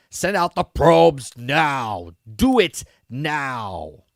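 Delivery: mock villain order, slow and drawn out; imitating an imperious order; commanding. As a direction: draw out each word as a forceful command. Villain barking an order, retold.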